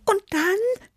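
very high, eager little cat voice; urging. A narrator's reading. Tibi wants Chili's story to continue, urging. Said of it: "drängte Tibi".

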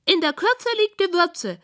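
high piggy voice quoting wisdom; high, lofty pig voice; self-important pig. A narrator's reading. Pig defends her short poem.

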